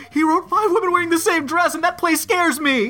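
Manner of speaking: mock fear. Hammed-up horror skit for promo.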